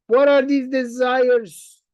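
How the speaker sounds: slow, loud teasing question; emphatic rhetorical question. Shown as a demonstration. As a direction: draw the question out for effect. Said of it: "rhetorical question to audience".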